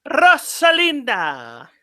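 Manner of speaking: high, drawn-out theatrical shout-out; mock-grand introduction; hammed-up name call. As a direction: stretch the name out high, with a flourish. Echoes the emailer's name with flourish.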